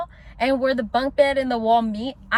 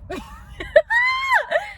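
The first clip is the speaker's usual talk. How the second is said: long high shrieking laugh; real laughing fit. Real, not acted. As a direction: laugh helplessly at the memory. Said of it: "I'm laughing because".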